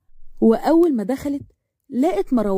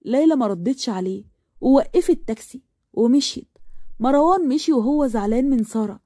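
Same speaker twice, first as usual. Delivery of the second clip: plain reading voice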